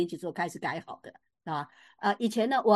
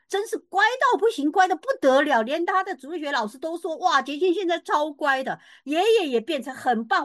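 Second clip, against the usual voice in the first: delighted; 5 semitones higher, 5 dB louder than usual. Real, not acted. Joyful report of the change.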